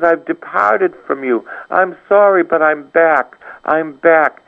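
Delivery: high, ringing call to repent; fervent preaching. Acted. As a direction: plead with the congregation to come back. Preaching at full force, pitch raised.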